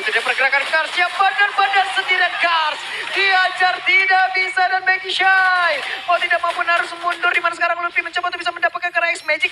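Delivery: fast hyped play-by-play; esports caster. Acted. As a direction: call the fight like a caster.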